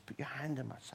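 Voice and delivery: whispered, intimate; Jesus gently inviting Thomas to touch; hushed invitation. Retold or imitated. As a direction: draw Thomas close and whisper. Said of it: "to say".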